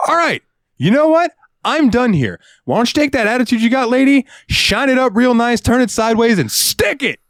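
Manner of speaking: high, mocking rant; mock telling off. Cashier retells telling off a customer in a high sassy rant. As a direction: sass her off with flair. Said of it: "And I said".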